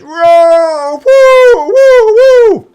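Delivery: roaring crowd member cheering the winner; cheering guy. Acted. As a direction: whoop like an excited fan. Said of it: "the guy goes".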